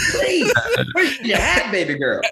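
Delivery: lightly voiced worried mother; mock fussing parent. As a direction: play a fretting parent at the bus stop. Mock parent scolding a kid.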